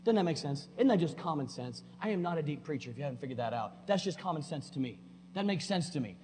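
ordinary delivery

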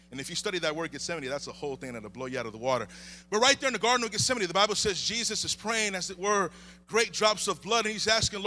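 usual speaking voice

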